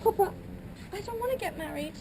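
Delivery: high, fast, close to tears; panicked bride. Acted. Bride begging her father before the wedding, tearful. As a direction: cry out for Papa in desperation.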